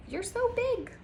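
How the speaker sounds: tiny high-pitched puppy voice; little dog voice. Acted. Small dog talking to a big dog, high tiny voice. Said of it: "She says".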